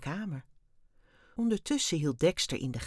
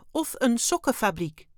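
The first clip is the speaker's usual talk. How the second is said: higher alien voice, falling at the end; offhand alien; 6 semitones higher, 5 dB louder than usual. A narrator's reading. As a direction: add it casually as a statement. Wim casually adds an option. (luchtig, terloops opgemerkt)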